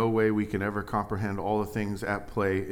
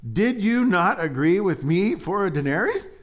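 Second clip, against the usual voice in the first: higher, louder question; scripture reading; 10 semitones higher, 8 dB louder than usual. Acted. Preacher reading the owner's reply, raised and pointed.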